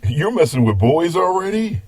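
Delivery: mock surprise. Real, not acted. Grandpa teases granddaughter.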